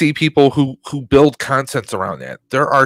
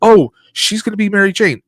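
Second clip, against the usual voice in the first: higher-pitched mimicry of overeager fans; mock excited fans; 7 semitones higher than usual. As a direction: jump to the conclusion, mockingly excited. Mimics fans jumping to conclusions.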